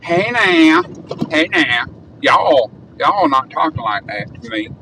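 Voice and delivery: put-on Southern drawl; Southern accent. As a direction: try on a Texan-Southern twang. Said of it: "maybe that was more Southern".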